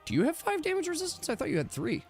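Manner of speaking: ordinary delivery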